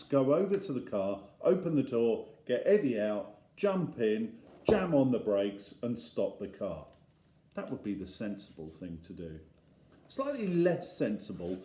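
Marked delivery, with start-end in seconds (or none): none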